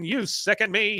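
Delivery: high-pitched mock disgust; imitated indignant disgust. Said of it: "I like how he says it".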